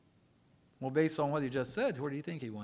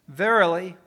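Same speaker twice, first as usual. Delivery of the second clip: emphatic proclamation; 3 semitones higher, 13 dB louder than usual. Acted. Quoting Jesus, emphatic opening word.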